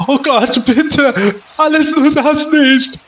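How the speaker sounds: high, desperate pleading; panicked begging. Acted. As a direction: beg like your life depends on it. Victim begs in panic.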